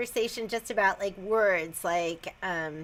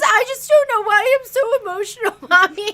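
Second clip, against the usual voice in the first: high, loud mock whine of a tearful kid; emotional daughter imitation; 8 semitones higher, 7 dB louder than usual. Mom imitates her crying daughter, hammed-up emotional voice. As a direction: play the overwrought daughter calling for her mother. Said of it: "She goes".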